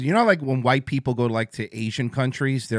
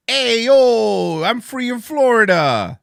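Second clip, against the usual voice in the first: higher, louder, slow "Hey, yo" hype call; loud party shout; 9 semitones higher, 7 dB louder, slower than usual. Pretend. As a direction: holler it like a party guy. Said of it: "And A is".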